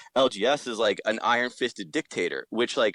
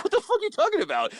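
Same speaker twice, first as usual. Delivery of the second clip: high, rushed disbelief; pitched-up "what on earth" reaction; incredulous retort; faster than usual. Retold or imitated. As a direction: fire it back fast and much higher, baffled. Incredulous retold reply to others.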